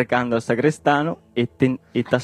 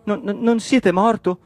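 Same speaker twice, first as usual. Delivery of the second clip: high-pitched stuttering question; trembling, incredulous stammer; frightened stammer; 11 semitones higher, 4 dB louder than usual. Acted. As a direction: stumble on the first word, aghast. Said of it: "balbettò Cirlinciò, apprestandosi tutto tremante".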